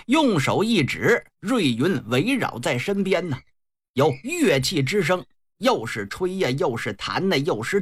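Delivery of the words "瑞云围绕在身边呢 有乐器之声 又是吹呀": ordinary delivery, nothing marked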